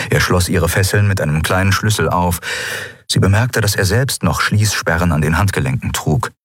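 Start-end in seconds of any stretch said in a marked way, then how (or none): none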